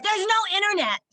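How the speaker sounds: high, loud mock panic; mock alarm. Wife telling husband the internet is down, mock alarm. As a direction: blurt it out like disaster struck. Retold announcing the outage to husband.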